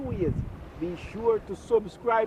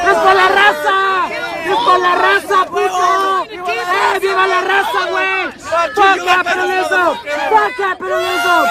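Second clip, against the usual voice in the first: loud, defiant protest shouting; real rallying yell at a protest; shouted slogans; 4 dB louder than usual. Real, not acted. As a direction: shout slogans across a hostile crowd.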